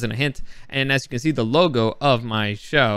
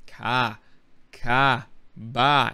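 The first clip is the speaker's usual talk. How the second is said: Australian accent practice; much slower than usual. Shown as a demonstration. Vlogger losing the accent and practising it, Australian accent. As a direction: separate test words in an Australian accent. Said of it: "I'm losing the accent".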